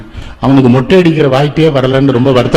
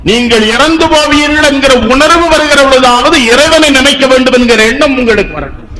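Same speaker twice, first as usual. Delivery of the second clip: high-pitched, sustained sermon delivery; preacherly exhortation; 10 semitones higher than usual. Retold or imitated. Speaker explains the saint's warning to mortals, high preacherly exhortation. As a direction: urge them in a raised pulpit voice.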